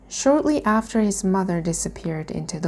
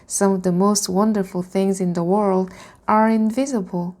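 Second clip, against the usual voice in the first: ordinary delivery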